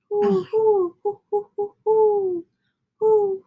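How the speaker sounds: owl hoot. A narrator's reading. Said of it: "And then Pa called".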